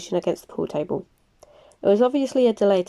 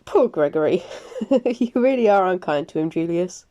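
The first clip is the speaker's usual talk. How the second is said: amused chuckle. Acted. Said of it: "Alexander let out an amused chuckle".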